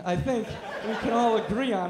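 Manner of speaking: normal talking voice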